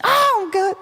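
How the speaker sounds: put-on cheerful little kid; bright child voice; high kid voice.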